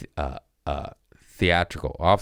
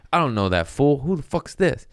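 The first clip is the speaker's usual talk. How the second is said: mock dismissive; 9 semitones higher than usual. Denying his agent, higher pitch.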